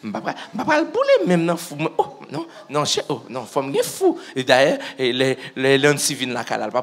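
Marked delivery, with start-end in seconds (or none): 0.0-1.3 very high, crying-laughing voice, rushed at the end; squealing, half-laughing quote; high excited plea. Retold or imitated. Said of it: "t'as dit". 2.0-2.5 high protest. Retold or imitated. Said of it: "t'as dit".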